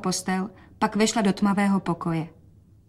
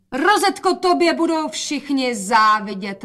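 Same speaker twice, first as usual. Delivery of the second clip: higher, louder cheerful call; 6 semitones higher, 4 dB louder than usual. A narrator's reading. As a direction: call loud for father's sake. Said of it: "volala matka". (hlasité volání)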